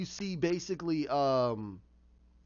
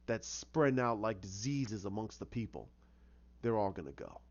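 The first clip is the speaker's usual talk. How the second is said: low, softer, menacing monologue; brooding vigilante; 5 semitones lower, 4 dB quieter than usual. Retold or imitated. Jason Todd before his wall of heroes, brooding menace. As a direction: voice a quiet threat, brooding to himself. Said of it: "he's sitting there going".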